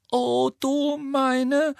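high, drawn-out gasp of dismay. A narrator's reading. Student watching Hagrid fight, gasping. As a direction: gasp it out, aghast. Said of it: "keuchte Hermine".

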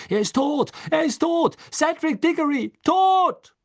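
high, loud shrieks rising to a scream; screeching. A narrator's reading. Crowd passing the news into the night, screeching. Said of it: "kreischten die Worte in die Nacht hinaus".

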